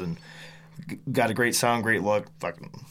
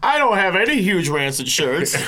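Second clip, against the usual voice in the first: put-on whiny kid voice; 8 semitones higher, 7 dB louder than usual. Joins the mock kid bit.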